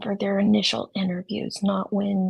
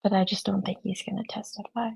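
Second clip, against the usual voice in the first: golf commentator; faster than usual. Shown as a demonstration. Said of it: "I forgot to use my golf voice".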